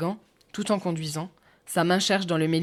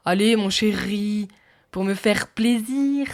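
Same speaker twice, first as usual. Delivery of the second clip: slow, higher, louder mock girl with marked Rs; high-pitched teasing mimicry; 3 semitones higher, 6 dB louder, slower than usual. A narrator's reading. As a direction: mimic her coaxing, stretching it out high and loud. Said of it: "Sur un ton aigu, en rigolant".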